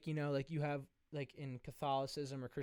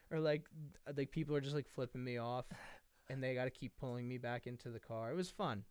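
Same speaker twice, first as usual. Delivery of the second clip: ordinary talk, nothing marked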